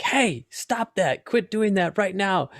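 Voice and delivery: imitating a stern person shouting a reprimand; mock angry yelling. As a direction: snap at someone to stop it right now. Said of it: "if somebody's yelling at you".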